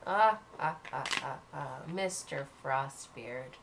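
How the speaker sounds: low, slow menacing cackle and taunt; low slow evil villain voice; sinister villain. Acted. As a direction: creep up on him with a dark laugh. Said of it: "a sinister laugh".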